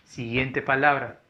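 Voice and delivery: ordinary delivery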